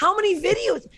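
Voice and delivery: high, incredulous outburst; getting heated. Real, not acted. Guest challenging rival troupe's award entry, getting heated. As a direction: pitch shoots up in exasperation. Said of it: "I'm getting heated again".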